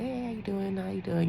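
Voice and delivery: be nice to strangers; soft friendly greeting. Retold or imitated. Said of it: "I'm just being friendly".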